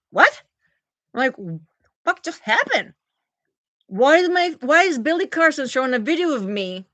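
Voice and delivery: high, loud disbelief; retold shock. Streamer seeing own face in friend's video, retold shocked disbelief. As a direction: act out being blindsided and baffled.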